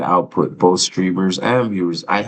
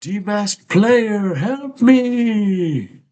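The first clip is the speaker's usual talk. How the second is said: much higher voice, hammed-up summons; mock heroic cry; 14 semitones higher, much slower than usual. Begging the chat for help, high hammed-up call. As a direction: call the heroes like a cartoon.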